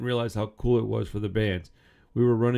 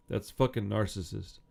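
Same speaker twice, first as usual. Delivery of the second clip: normal talking voice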